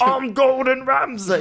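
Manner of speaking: a deliberately terrible Ramsay impression through laughter; bad Gordon Ramsay. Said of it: "my Gordon Ramsay is just like".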